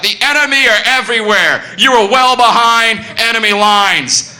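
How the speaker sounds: high, loud, slow barked orders; soldier shouting. Retold or imitated. Steward soldier yelling at the queue, loud slow barking. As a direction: bellow it like a drill soldier. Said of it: "shouting at all of us".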